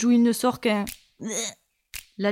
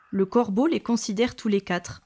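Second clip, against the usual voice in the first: ordinary delivery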